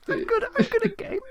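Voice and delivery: very high, small and hurt; squeaky wounded protest; tiny voice. Retold or imitated. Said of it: "in a tiny voice was like".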